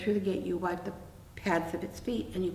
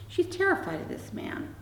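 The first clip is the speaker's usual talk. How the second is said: ordinary talk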